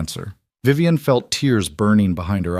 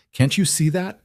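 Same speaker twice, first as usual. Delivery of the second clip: higher, pleading raised voice; 9 semitones higher than usual. A narrator's reading. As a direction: push the voice up in frustration. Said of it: "his voice rising".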